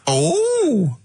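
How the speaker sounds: high, hammed-up camp greeting; mock catchphrase delivery, pitched high; crude comedy character. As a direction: swoop up into a silly wacky-character voice. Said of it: "it's Dirty Old Queer".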